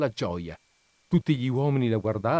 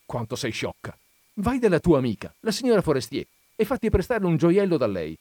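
bright idea, building to a higher pitch; exclaiming; 6 semitones higher than usual. A narrator's reading. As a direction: begin chiding, then urge her on with lift. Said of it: "Il marito esclamò".

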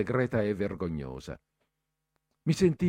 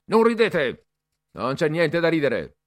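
high, loud snapped order; shouted rebuke; 6 semitones higher, 6 dB louder than usual. A narrator's reading. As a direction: cut the laughter dead. Cadet silences laughter. (Bertoli zittisce le risate, gridando)

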